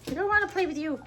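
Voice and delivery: high, loud put-on dog voice refusing to play; voicing the dog. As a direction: play Max the dog turning her down. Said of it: "And Max is like".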